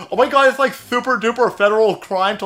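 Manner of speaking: high, loud whiny parody; mock outrage. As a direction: whine it like an indignant tattletale. Mocking CNN Legal's threat.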